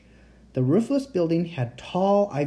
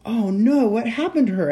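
alarmed; 8 semitones higher, 6 dB louder than usual. A narrator's reading. Hears her friend has disappeared.

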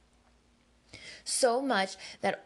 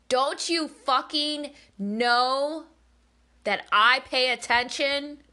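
higher, louder, slow furious yelling; 6 semitones higher, 8 dB louder, slower than usual. Retold or imitated. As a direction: hurl it at your mother in a rage. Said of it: "and I was fucking yelling".

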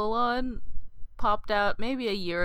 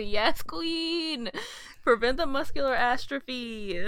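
sassy cheer; 6 semitones higher, slower than usual. Retold or imitated. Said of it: "I said".

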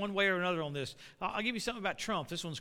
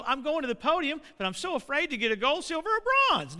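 mock fearful athlete; 7 semitones higher, 5 dB louder than usual. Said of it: "somebody in the Olympics go".

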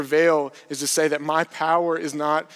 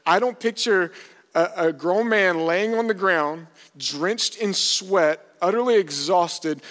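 raised, louder voice in disbelief; higher, forceful incredulity; incredulous preaching; 4 semitones higher, 3 dB louder than usual. Real, not acted. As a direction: preach it emphatically, piling up the details. Preacher contrasts victory with pitiful picture.

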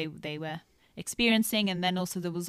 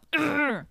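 short growl, pitched up; demonstrated frustrated growl; 7 semitones higher, 4 dB louder than usual. Shown as a demonstration. Showing someone how to vent emotion, frustrated growl. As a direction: let out a quick venting "grr". Imitates a venting noise between examples.

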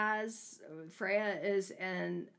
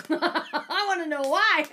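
laughing; 7 semitones higher, 9 dB louder than usual. Real, not acted. Laughing, eager to know.

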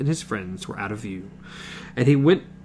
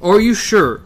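higher, louder startled question; surprised; 6 semitones higher, 5 dB louder than usual. Acted. Roland, surprised by the old woman's gift, asks. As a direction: let the surprise jump out. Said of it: "Now it was Roland's turn to be surprised".

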